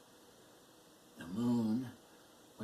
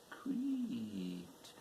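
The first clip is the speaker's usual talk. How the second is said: creaking word; 4 dB quieter than usual. A narrator's reading. Poem reciter voices the stable wicket, creaking. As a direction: stretch the word like a creaky gate.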